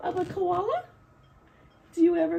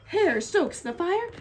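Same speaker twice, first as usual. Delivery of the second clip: ordinary delivery, nothing marked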